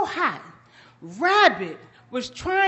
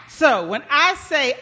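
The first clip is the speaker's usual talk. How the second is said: usual speaking voice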